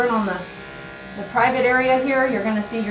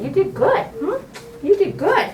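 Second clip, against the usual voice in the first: high, sing-song praise for a good dog; 8 semitones higher, slower than usual. Real, not acted. Praising the dog after grooming.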